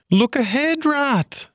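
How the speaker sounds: very high, alarmed warning; urgent high-pitched alarm; sudden cry. A narrator's reading. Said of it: "cried the Mole suddenly".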